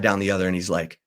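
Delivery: usual speaking voice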